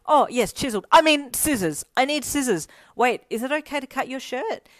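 high, loud scramble to correct yourself; flustered blurting. A narrator's reading. Caught staring at a shirtless friend, loud and flustered. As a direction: blurt it out in embarrassed panic.

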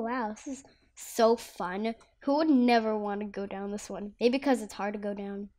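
ordinary talk, nothing marked

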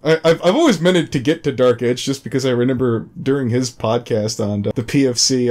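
ordinary delivery

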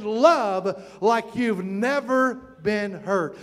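every word drawn out and weighed; slow, weighty quote. Acted. Preacher slowly delivering the key quote to his congregation. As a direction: let the line land slowly, word by word. Quoting Paige's famous line.

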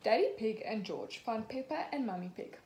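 usual speaking voice

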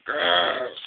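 short rolled "grrr"; cartoon growl. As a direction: growl like a cross engine. Diesel growls at the twins.

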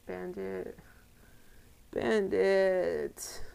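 theatrical lament for a fallen pet; mock grief. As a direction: call out the name in mock sorrow. Mourning fainted Pokemon.

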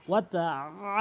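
"আ" as a long squeezed throat ain after "ওয়া তা"; throaty ain. Shown as a demonstration. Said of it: "আইনের উচ্চারণ করতে হবে".

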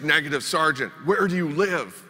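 a touch of a stubborn dim Marine; stubborn refusal. Retold or imitated. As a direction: refuse and keep quizzing. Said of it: "And he's like".

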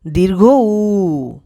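"দীর্ঘ উ" very slow, উ held and falling; model pronunciation. Shown as a demonstration. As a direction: sound out "দীর্ঘ উ", stretching the উ. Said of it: "চলো আরেকবার পড়ি". ("দীর্ঘ উ", শেষের উ টেনে)

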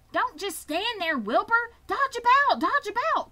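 high, loud, frantic goose cry; commanding goose. A narrator's reading. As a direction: cry out urgent orders as a bossy goose. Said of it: "took command and began to give orders".

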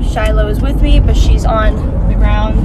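normal talking voice

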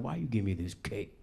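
mock tough guy brushing off a cake; gruff macho friend. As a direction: macho friend refusing his birthday cake. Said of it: "He was like".

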